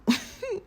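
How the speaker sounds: quick high bemused laugh; a bemused little laugh at the odd title; short laugh. Real, not acted. Laughs at the confusing video title.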